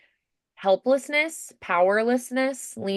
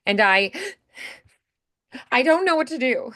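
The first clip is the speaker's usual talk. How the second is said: choking up; 5 semitones higher than usual. Shown as a demonstration. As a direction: voice leaps higher as it chokes. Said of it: "like you're starting to choke up".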